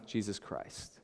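normal talking voice

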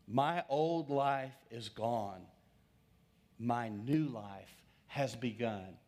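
slow declaration spoken aloud; confession of faith. Shown as a demonstration. Preacher models a personal declaration for the congregation, slow. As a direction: proclaim it slowly as your own. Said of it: "here's how you would say it".

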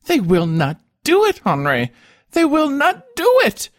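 put-on high, loud wail of a thwarted child; tearful, stammering. A narrator's reading. Weeping wife turning to her husband, tearful wail. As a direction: wail it like a spoiled child denied a wish. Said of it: "with a voice full of tears".

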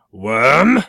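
loud challenge with a rising call; knightly cry. Acted. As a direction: call out to the dragon's lair like a knight. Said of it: "he cried".

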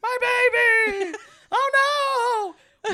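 high drawn-out wailing yell; hammed-up yelling. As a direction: flail and wail like a panicked mom. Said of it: "the flowy guy yelling".

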